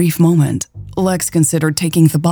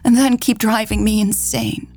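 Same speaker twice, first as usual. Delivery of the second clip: higher, hurt and heated retort; 5 semitones higher than usual. A narrator's reading. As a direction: throw the blame back at her. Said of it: "Mary retorted".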